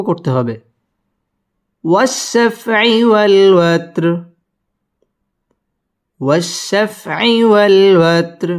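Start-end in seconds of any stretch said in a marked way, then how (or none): 1.8-8.5 drawn-out melodic chant; slow Quran recitation. Shown as a demonstration. Teacher recites the verse for students. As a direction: recite it slowly with tajwid.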